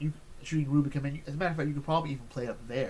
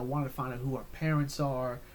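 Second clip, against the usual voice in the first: usual speaking voice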